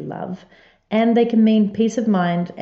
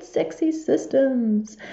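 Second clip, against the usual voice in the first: "sexy" stretched very slowly, a bit higher; drawn out; 5 semitones higher, 3 dB quieter, much slower than usual. Acted. Host repeats her catchphrase, drawn out slow and playful.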